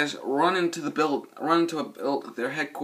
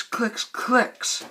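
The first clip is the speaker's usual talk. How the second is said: predator clicks; 9 semitones higher than usual. Retold or imitated.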